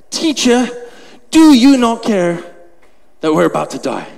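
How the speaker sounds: mildly dramatic reading of a plea; slightly dramatic. Acted. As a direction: read it with a touch of drama. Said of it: "So slightly dramatic".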